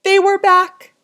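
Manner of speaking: high and loud, a sudden shock; shocked reveal. A narrator's reading. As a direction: hit it like a jump scare. Underwear reappears in bathroom.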